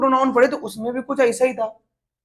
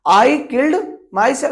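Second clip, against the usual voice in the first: slow dictation; much slower than usual. Shown as a demonstration. Dictating an example sentence.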